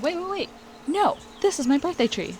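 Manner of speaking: high, sudden burst of recognition; excited discovery. Real, not acted. Noticing her birthday tree, high excited voice. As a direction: stop everything, you just spotted it.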